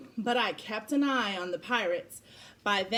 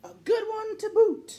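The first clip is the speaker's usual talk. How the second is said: high, hammed-up pirate squawk; 12 semitones higher, 5 dB louder than usual. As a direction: squeak it out like a silly pirate. Repeat of pirate line, much higher.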